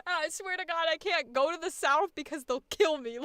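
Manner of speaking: normal talking voice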